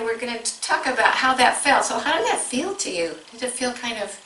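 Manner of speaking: ordinary delivery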